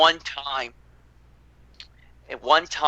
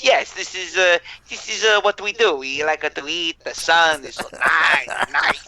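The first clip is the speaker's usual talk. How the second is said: Italian naval architects; 3 semitones higher than usual. Retold or imitated. Italian designers answering horrified Germans about the sun, mock-contented. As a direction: play blissfully unbothered Italians, stretching out the end. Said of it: "Italian naval architecture's response to the Germans".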